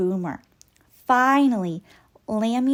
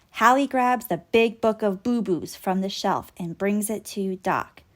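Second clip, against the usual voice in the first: plain reading voice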